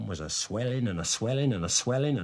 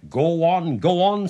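louder, insistent prodding; urging on; 5 semitones higher, 7 dB louder than usual. A narrator's reading. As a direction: press him eagerly to continue. Wife urges him on.

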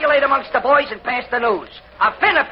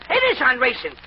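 ordinary talk, nothing marked

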